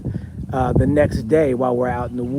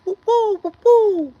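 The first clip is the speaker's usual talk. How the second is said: high hooting bird call, whoop woo; imitated bird call; 5 dB louder than usual. Camper imitating the strange birds heard in the woods, high hooting call. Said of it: "far away at first".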